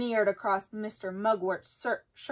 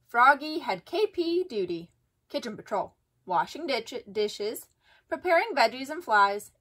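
ordinary delivery, nothing marked

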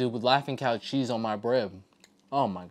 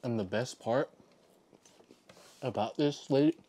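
talking with a full mouth; 6 dB quieter than usual. Real, not acted. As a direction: chew and talk at once. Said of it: "I keep talking with my mouth full".

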